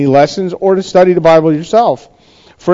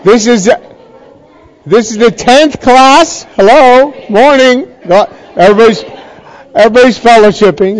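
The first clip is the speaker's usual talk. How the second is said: raised, higher voice over the room; calling out; 7 semitones higher, 4 dB louder than usual. Real, not acted. Teacher to a chatting class before prayer, calling out. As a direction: call the class to order.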